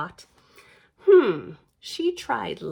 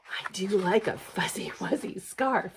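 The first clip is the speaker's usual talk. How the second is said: usual speaking voice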